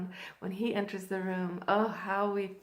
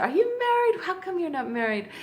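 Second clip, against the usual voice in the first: high, loud start, coming down after; playful astonishment; 8 semitones higher, 6 dB louder than usual. Retold or imitated. Joking men ask if she's married, playfully amazed. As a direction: imitate a flirt's amazed questioning. Voicing men teasing her.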